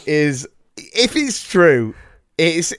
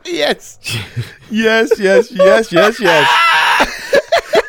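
high, loud triumphant cheering; real crowing delight; proud of himself; 6 semitones higher, 4 dB louder, much slower than usual. Real, not acted. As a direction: celebrate your own joke, repeated yeses climbing higher. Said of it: "Look how proud of yourself you are".

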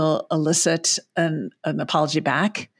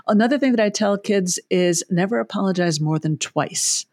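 normal talking voice

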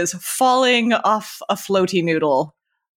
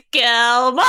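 calling out; 11 semitones higher, 4 dB louder than usual. Acted. Calling out after falling.

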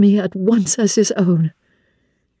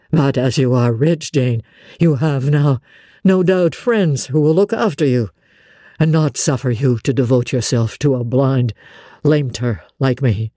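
lower, weary voice of a blind master; a lower, tired Rochester warming to Jane; brooding Rochester; 8 semitones lower than usual. Acted. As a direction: let him sound worn and fond.

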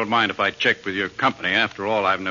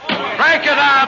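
calling out; 5 dB louder, slower than usual. Acted. Policeman breaking up a crowd.

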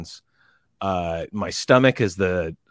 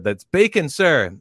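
higher, loud recruit's reply; recruit answer; 7 semitones higher, 3 dB louder than usual. Retold or imitated. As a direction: sound off to the drill instructor. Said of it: "I said".

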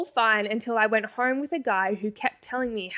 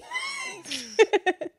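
shrieking giggle that falls away; high squealing laugh. Real, not acted. Laughing after calling Amy.

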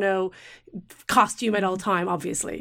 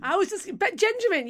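delighted; 10 semitones higher than usual. Real, not acted. Excited reaction to the name.

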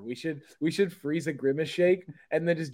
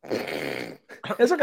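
much lower, very slow, dragged out; mock sulky echo; 11 semitones lower, much slower than usual. Mocking a friend's reply, slow and sulky. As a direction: echo him like a wounded sulk.

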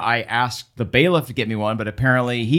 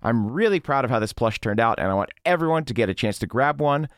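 usual speaking voice

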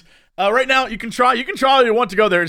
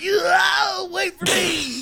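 distant call; 9 semitones higher, much slower than usual. Retold or imitated. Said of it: "You were like".

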